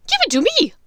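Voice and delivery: snatch at it with a shout; greedy witch shouting; demanding Bellatrix. Acted. Voices Bellatrix.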